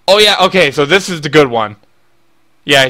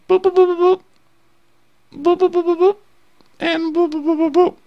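high repeated beeping laser noise; laser sound effect. Retold or imitated. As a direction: go boop like a toy laser. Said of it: "That's what lasers sound like".

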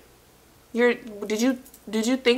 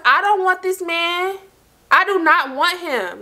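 higher-pitched, exasperated retort; irritated; 7 semitones higher than usual. Retold or imitated. Telling friend she doesn't want the man, irritated. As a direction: insist with mounting irritation, starting high. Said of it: "So now I'm getting irritated".

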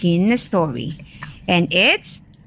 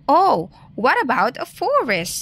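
mild high-pitched squeak; a lightly voiced squeaky rabbit; 6 semitones higher than usual. A narrator's reading. Rabbit suggests a setting to Wolf, mildly squeaky. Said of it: "squeaked Rabbit".

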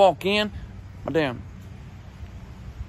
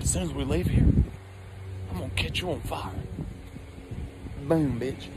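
quiet threat; 4 semitones lower, 7 dB quieter than usual. Retold or imitated. Threat whispered beside uncle.

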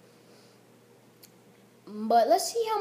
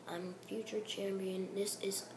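whispered along; 5 semitones lower, 17 dB quieter than usual. Real, not acted. Player whispering the champion's promo line along with the game. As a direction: whisper it under the breath.

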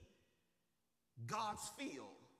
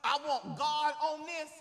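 raised pitch, hard punches on key words; preaching at full force; 7 semitones higher, 11 dB louder than usual. Real, not acted. Preacher urging the church never to forget it is God, forceful preaching. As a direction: build to loud, insistent peaks.

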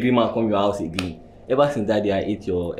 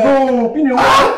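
loud, high happy hello; excited greeting; 14 semitones higher, 8 dB louder, slower than usual. Acted. Friend greeting husband after long absence, excited. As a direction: greet an old friend with delight.

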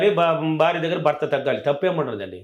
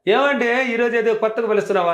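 surprised wife; 7 semitones higher than usual. Retold or imitated. Wife surprised by husband's tender call.

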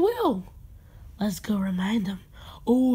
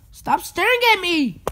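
raised-pitch burst of annoyance; annoyed yell; 6 semitones higher, 3 dB louder than usual. Acted. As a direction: cry out, fed up with the staring. Fed up with being stared at.

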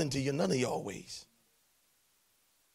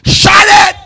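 a full-throated, much higher and louder "Shut it!"; shouted command; 13 semitones higher, 22 dB louder than usual. Acted. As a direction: preach it like a thunderclap.

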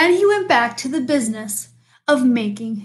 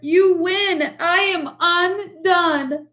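high, drawn-out lament; blubbering surrender; 6 semitones higher, slower than usual. A narrator's reading. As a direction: wail in theatrical defeat. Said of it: "he blubbered".

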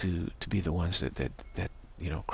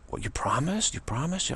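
"promised" lifted high as a question, higher the second time; questioning for a promise; 6 semitones higher than usual. Acted. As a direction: press the question on "promised", rising. Mom presses child for a promise.

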